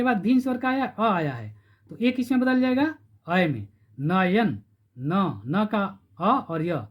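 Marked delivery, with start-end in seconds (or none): 5.0-6.9 न, न का अ और य taken apart slowly, sound by sound; slow syllable breakdown. Shown as a demonstration. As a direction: spell out the syllables of नयन slowly. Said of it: "न में अ छिपा हुआ है". (शब्द को धीरे-धीरे तोड़कर बोलना)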